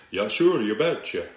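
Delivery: put-on upper Midwestern accent. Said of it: "someone from the upper Midwest say".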